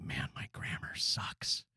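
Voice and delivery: slow unvoiced whisper to himself; muttered to self. Fretting podcaster whispers his own doubts. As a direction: whisper it like a private worry. Overthinker inner voice.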